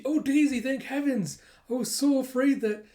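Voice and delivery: high, gushing distraught owner; acted overwhelmed relief. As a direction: gush with relief at a rescued pet. Owner reunited with her dog.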